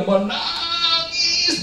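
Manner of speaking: the word stretched into a high held wail; hammed-up sustained cry; mock crying. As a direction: imitate weeping by drawing the word out high. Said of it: "benar-benar dia menangis".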